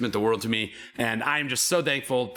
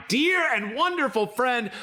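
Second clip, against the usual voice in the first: hype introduction; 10 semitones higher than usual. Acted. Introducing the next player like host.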